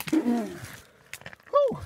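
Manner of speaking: breathy whew after crawling; effort grunt. Real, not acted. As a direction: exhale hard after climbing out. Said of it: "Sorry for all the grunting".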